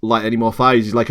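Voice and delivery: usual speaking voice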